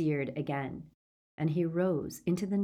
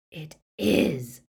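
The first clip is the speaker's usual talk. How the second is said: rasping; 5 dB louder than usual. A narrator's reading. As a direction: rasp the words out. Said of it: "rasped Greyback".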